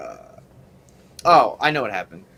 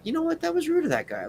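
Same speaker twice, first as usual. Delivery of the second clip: much higher, huffy and offended; indignant inner voice. Retold or imitated. Driver fuming to himself about the rude guy, mock indignant. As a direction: play up the outrage at the rude driver. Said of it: "I'm like".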